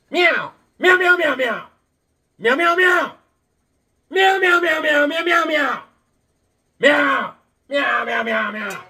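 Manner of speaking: loud, high-pitched meowing; rapid-fire meows, arguing in cat; mock cat talk. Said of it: "I speak cat".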